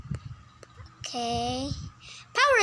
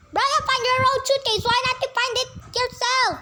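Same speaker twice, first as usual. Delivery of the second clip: loud; 7 semitones higher, 4 dB louder than usual. Acted. Yelling at Brian.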